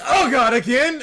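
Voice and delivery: high, loud groaning dismay; exasperated. Acted. Pip yelling in dismay at being yanked back. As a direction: wail it like a fed-up summoned imp.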